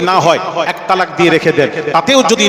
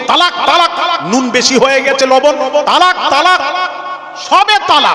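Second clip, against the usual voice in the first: high, rapid mock outburst; mocking hasty husbands; 7 semitones higher than usual. Preacher mocking men who shout divorce over salt. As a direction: parody the hotheaded husband.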